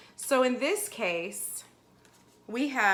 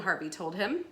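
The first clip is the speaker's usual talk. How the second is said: usual speaking voice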